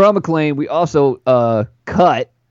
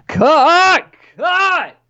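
excited shout; much slower than usual. Real, not acted. Celebrating a rival player's cut.

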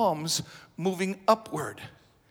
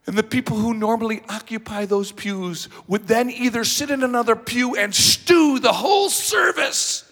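louder, higher indignant preaching; rising pulpit indignation; heated preaching; 5 semitones higher, 9 dB louder than usual. Acted. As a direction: build the outrage toward the climax. Preaching at full force.

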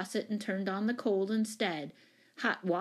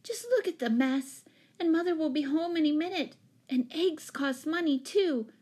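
high, fretful complaint; exasperated wail, pitched up; wailing dismay; 9 semitones higher than usual. A narrator's reading. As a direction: fuss over the disaster in a high voice. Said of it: "wailed Jane".

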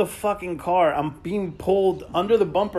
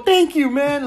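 higher, loud mock gratitude; sarcastic thanks; 9 semitones higher, 5 dB louder, slower than usual. Victim offered twenty dollars, sarcastic thanks. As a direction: thank him with heavy sarcasm. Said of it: "Like, I'm gonna go".

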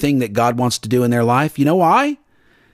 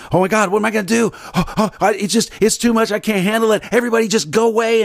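fast, higher, flustered babble; mock panic; 5 semitones higher, faster than usual. Preacher imitating someone panicking, fast and higher. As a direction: play the overwhelmed person freaking out.

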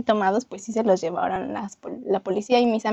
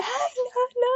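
friend's mock panic; 14 semitones higher, much slower than usual. Friend extremely upset.

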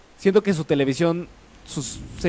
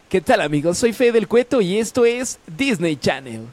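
higher, bright announcer voice; 5 semitones higher than usual. Shown as a demonstration. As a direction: pitch it up like a kid host. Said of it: "Pero aguda". (voz aguda de presentador)

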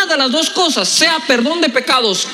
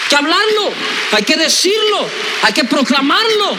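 high-pitched preaching cries, word by word; rousing exhortation; 5 semitones higher, slower than usual. Real, not acted. Preacher urging the church to declare it, high, impassioned cries. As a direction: fire off each command like a proclamation.

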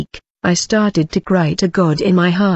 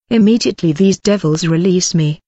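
ordinary delivery

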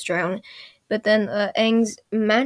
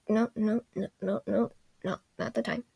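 much softer, rapid string of refusals; quiet self-correction; 9 dB quieter than usual. Real, not acted. Narrator stopping before the bad news, hushed self-check. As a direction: drop the volume, talking yourself out of it.

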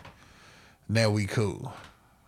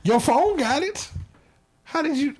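much higher, mocking incredulous voice; sarcastic squeak of fake shock; mock disbelief; 4 dB louder than usual. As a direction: pretend to be stunned by her excuse. Mocking the girl's excuse.

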